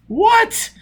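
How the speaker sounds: very high, loud, stunned what; thunderstruck. A narrator's reading. Foyle learning the captain's colony, thunderstruck. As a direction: gasp in disbelief. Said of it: "Foyle was thunderstruck".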